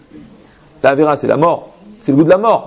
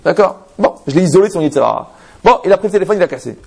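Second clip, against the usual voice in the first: normal talking voice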